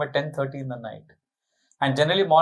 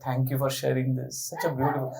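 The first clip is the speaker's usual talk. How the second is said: much softer, lower, gentle thanks; soft guru thanks; 5 semitones lower, 6 dB quieter, faster than usual. Retold or imitated. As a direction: hush it like a kind elder.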